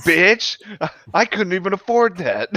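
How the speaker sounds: high, loud mock outrage; hammed-up indignant ghost; angry ghost. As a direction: play an envious, fuming ghost. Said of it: "Fucking angry, over traumatic ghost".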